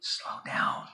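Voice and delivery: unvoiced hushed "slow down"; buddy whispering the answer across the hallway; whispered answer.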